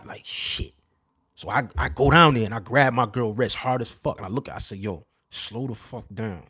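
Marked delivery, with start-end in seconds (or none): 0.0-0.7 a breathless unvoiced whisper of alarm; whispered curse. Spotting the men in the stairwell, whispered curse. 4.8-6.4 much softer and slow, low-voiced command; urgent hushed warning. Warning his girl near the strangers, hushed and slow.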